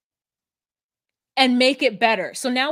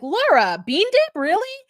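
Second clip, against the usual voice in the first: much higher, questioning lift; mock incredulous confrontation; 9 semitones higher, 4 dB louder than usual. A D turning on Laura over bean dip, mock incredulous confrontation. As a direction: imitate someone calling out a friend in disbelief.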